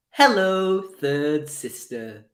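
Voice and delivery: mock-villain greeting dripping with disdain; imitated vitriol. Fan quoting a favorite Kenobi line, with theatrical vitriol. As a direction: draw the last words out slowly, with venom. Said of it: "You can just hear the vitriol in it".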